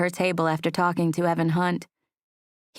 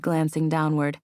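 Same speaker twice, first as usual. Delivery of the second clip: usual speaking voice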